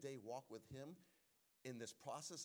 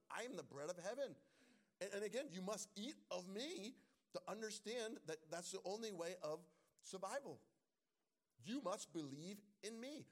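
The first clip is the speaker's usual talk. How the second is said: higher and louder, preached with fire; fervent preaching; 7 semitones higher, 4 dB louder than usual. Acted. Pastor voicing Jesus to the congregation, raised fervent preaching. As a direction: proclaim it as Jesus, lifting the voice.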